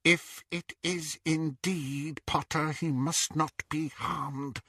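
soft, slow, high muttering; muttered. A narrator's reading. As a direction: mutter to herself. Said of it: "she muttered".

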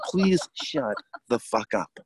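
word by word, drawn out; mock-pleading, spaced out for effect; slow, emphatic plea. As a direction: beg a friend slowly to stop talking. Retold plea to friend to stop.

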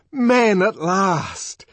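loud, high, long-drawn cry; jubilant, stretched-out shout; exultant. A narrator's reading. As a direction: shout it in triumph mid-fight. Savage exults amid the fight.

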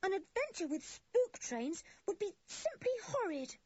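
high, tearful horror; a touch of horrified whine. A narrator's reading. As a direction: dread in every word. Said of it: "said Anne in a horrified voice".

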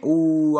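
long, loud, eager "ooh". Real, not acted. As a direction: let out a loud, drawn-out ooh. Excited about New England fans.

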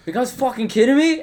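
high, loud retold incredulity; mock disbelief. Retelling bandmate's disbelief over extra beer.

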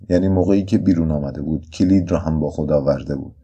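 ordinary delivery, nothing marked

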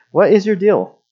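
high-pitched indignant demand; mock exasperation. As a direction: play up being fed up, pitch jumping. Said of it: "I'm just like, Mad".

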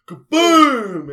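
loud, drawn-out kaboom; imitated crash. Friend retells Derek hitting the floor, booming crash sound. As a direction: boom it out high and long.

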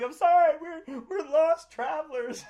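high, loud, hammed-up plea through laughter; mock pleading wail. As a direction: beg like a pitiful stranded traveler. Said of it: "I was like".

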